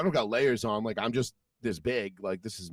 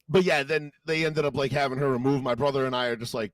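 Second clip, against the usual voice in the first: ordinary talk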